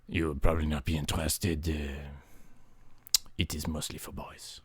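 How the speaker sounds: low, soft dismissive reply; hammed-up condescension; low snub. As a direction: brush them off.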